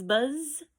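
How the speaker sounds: high buzzing bee sound. A narrator's reading. Teacher reading to her class, high bee buzz. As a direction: buzz like a bumblebee. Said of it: "He can sound like a bee".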